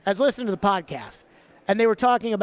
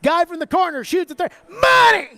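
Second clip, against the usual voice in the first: big Southern broadcaster shout on money; play-by-play call; 7 semitones higher, 5 dB louder than usual. Retold or imitated. Host doing a Tennessee play-by-play, shouting money. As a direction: call the three like a hometown announcer, yelling money. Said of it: "like Bert Bertelkamp doing the games".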